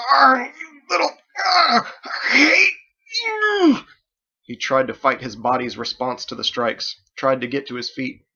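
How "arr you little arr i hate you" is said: very high, loud, cries of pain; pained howling curse. A narrator's reading. Bo doubled over after knee strikes, howling in pain. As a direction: groan out the hatred through agony.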